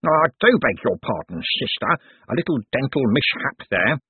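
ordinary delivery, nothing marked

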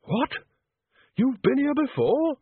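high startled question; shocked disbelief. Acted. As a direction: gasp it out in disbelief. Nanny shocked at Granny.

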